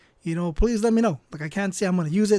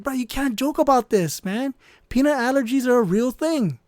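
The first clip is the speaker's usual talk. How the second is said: higher, louder retold protest; indignant scolding; 6 semitones higher, 4 dB louder than usual. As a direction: tell off a joking friend, pitched up. Retold scolding of prankster friend.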